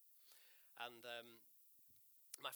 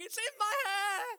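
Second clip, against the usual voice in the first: high, loud shriek of panic; hammed-up squeal of disgust; screaming woman; 12 dB louder than usual. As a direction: shriek it like a passenger covered in sick. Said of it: "the woman in front screaming and crying".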